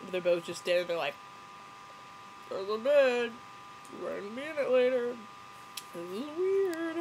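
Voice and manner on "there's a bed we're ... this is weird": high, uneasy couple impression; hammed-up embarrassed squeak; mock awkward. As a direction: imitate awkward newlyweds, stretching the last words.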